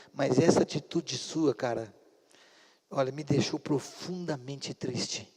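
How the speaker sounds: low, quiet, heartfelt reproach; tender sadness. Retold or imitated. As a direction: relive a father's gentle, pained words to his son. Pai confessa tristeza ao filho. (tom baixo, terno e triste)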